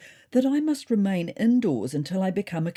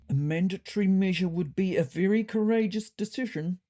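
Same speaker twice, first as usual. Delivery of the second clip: Squeak cautioning; 3 semitones higher than usual. A narrator's reading. Squeak to the new cat leader, cautioning. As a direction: caution the leader in another character's voice. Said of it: "cautioned Squeak".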